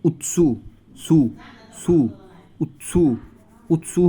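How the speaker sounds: "উৎসু" with the tongue tip tapping the teeth into a sharp ts; tsu sound demo. Shown as a demonstration. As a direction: say "উৎসু" as a t released straight into su. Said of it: "দাঁতের সাথে লাগবে". ("উৎসু"-তে জিভ দাঁতে লাগিয়ে ৎস উচ্চারণ)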